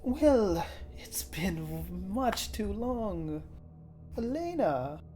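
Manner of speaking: slow, sly villain drawl; menacing old acquaintance. Acted. GM voices villain Lyle.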